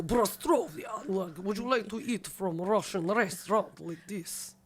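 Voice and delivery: slow, hammed-up fake Russian accent. Mocking how most people fake a Russian accent, slow and hammed-up. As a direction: ham up a bad Russian accent. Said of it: "most people go".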